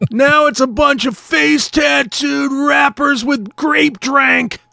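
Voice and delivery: put-on loud, slow grumbling old-timer; cranky old man. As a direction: complain like a grouchy elder. Continuing the mock rant.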